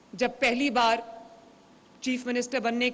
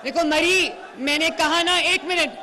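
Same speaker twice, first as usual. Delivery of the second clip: raised voice over the cheering; calling over crowd; 4 semitones higher, 4 dB louder than usual. Real, not acted.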